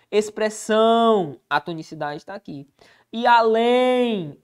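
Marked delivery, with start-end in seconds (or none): 0.1-1.4 Expressão drawn out slowly, the last syllable são pressed; teacher showing where the stress falls; slow stressed word. Shown as a demonstration. 3.1-4.4 além drawn out slowly, the final lém pressed; teacher showing the stress in além; slow stressed word. Shown as a demonstration.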